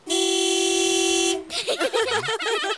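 loud, high hearty laugh at the buttons; booming cartoon laugh; big belly laugh. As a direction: burst out laughing, delighted. Daddy Pig laughs at buttons.

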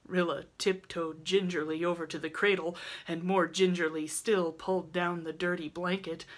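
ordinary delivery, nothing marked

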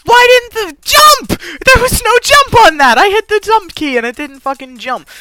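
high, loud protest, easing toward the end; outraged yelling. Real, not acted. Gamer whose jump key failed, outraged yelling. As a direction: shout in disbelief at the broken controls.

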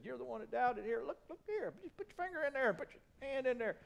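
high-pitched, urgent invitation; insistent challenge. Acted. Jesus to doubting Thomas, insistent and high-pitched.

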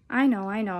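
plain reading voice

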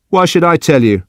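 high-pitched defensive retort. Acted. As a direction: push back in a high, sulky voice. Judson resists the questioning.

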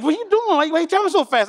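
high, alarmed mock nag; wife nagging. Wife scolding her husband for speeding, high and alarmed. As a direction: play the wife telling him off for speeding. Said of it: "And she's like".

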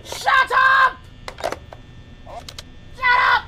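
loud high yell to silence someone; explosive, high-pitched outburst; angry shout. Pretend. Shouting down the game.

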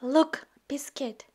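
ordinary delivery, nothing marked

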